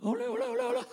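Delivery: brief wavering falsetto, kept soft; soft falsetto flourish, up and down; falsetto demo. Shown as a demonstration. Pastor showing the church what falsetto is, falsetto. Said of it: "Falsete es un tipo de voz".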